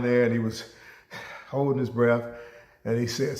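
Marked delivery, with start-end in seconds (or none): none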